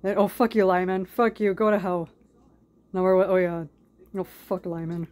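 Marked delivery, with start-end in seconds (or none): none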